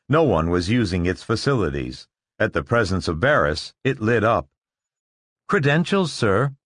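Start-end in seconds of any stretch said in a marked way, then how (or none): 5.5-6.5 high mechanical robot voice; crisp machine tone, raised pitch. Acted. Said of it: "it said".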